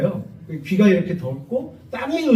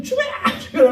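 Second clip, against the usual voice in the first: mock mob yell. Saojeong yells while throwing stones.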